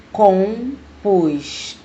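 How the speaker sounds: slow "Compostura" broken into separate syllables. Shown as a demonstration. Teacher showing an example word, "Compostura" slowly syllable by syllable. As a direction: say "Compostura" in parts, com-pos-tu-ra. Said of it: "Vamos ver no exemplo".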